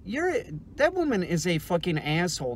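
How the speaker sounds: normal talking voice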